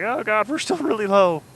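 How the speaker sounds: high, loud playful alarm; mock panic. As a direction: yelp it like a hammed-up nervous flyer. Acts out alarm at low altitude.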